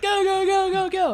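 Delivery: high, loud go go go; excited yelling. Retold or imitated. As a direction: shout it high and urgent. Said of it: "he's like".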